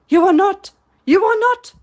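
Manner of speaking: high, loud, strained denial; captain refusing the impostor; hoarse cry. A narrator's reading. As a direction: shout it out desperately. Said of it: "cried Hook hoarsely".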